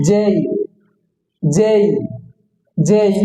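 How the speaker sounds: "যেই" gliding from je into i; correct letter J. Shown as a demonstration. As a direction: say "যেই" as a clear jay diphthong. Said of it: "এটা হবে সঠিক উচ্চারণ হবে".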